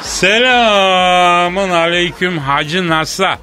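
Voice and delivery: long drawn-out vowels, mock-folksy hail; hammed-up phone greeting. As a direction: stretch the greeting as if calling across the world. Comic phone call to NASA. (uzata uzata, abartılı telefon selamı)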